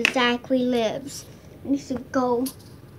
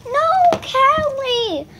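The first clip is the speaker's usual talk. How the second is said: calling out; 9 semitones higher, much slower than usual. Pretend. Calling runaway dog.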